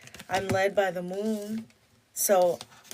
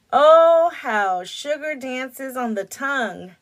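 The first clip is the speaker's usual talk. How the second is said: oh louder, savoring the words; excited read; 5 semitones higher, 10 dB louder, slower than usual. Real, not acted. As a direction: relish the line like a tasting. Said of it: "reading a card aloud".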